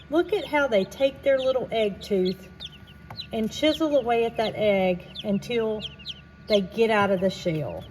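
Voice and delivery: usual speaking voice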